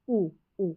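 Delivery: ऊ, ऊ as separate oo sounds. Shown as a demonstration. Teacher showing the vowel in soup, separated ऊ, ऊ. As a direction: isolate ऊ twice, as in सूप. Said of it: "ओ यू को भी ऊ पढ़ रहे हैं".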